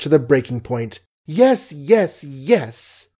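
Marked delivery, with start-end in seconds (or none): 1.3-3.1 high, emphatic outburst of yeses; sudden cry. A narrator's reading. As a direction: composure snaps into a raised cry. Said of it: "she cried suddenly".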